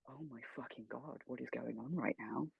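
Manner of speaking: hushed in awe, mid-hug; stunned hushed disbelief. Retold or imitated. Fan being hugged by his hero, hushed stunned disbelief. Retold shock during surprise hug.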